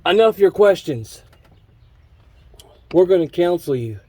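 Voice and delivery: hammed-up voice of the authorities; mock stern authority. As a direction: play the scolding officials. Mock voicing of authority figures.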